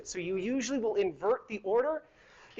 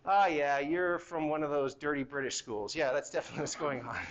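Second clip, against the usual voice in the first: lower, teasing put-down of another school; mock dismissive remark; 8 semitones lower than usual. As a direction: scoff at it like a snob, playfully. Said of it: "I can be like".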